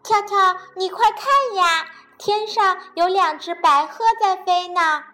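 bunny shouting to the frog about the cranes, excited. Acted. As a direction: call your friend to look up, thrilled. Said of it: "兴奋地喊道".

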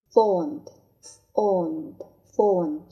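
fond said very slowly three times; slow drill. Shown as a demonstration. Teacher drills fond slowly for the class. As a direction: stretch each fond out. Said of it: "teacher drills word slowly".

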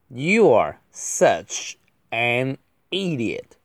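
very slow, gaps between words; drawn-out word-by-word delivery; slow model reading. Shown as a demonstration. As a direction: space out each word for learners. Slow model reading, then faster repeat.

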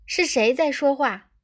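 high, loud indignant demand; angry shout. A narrator's reading. Computer demands to know who spoke, angrily. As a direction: demand the culprit like a cross schoolteacher. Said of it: "电脑怒喝道".

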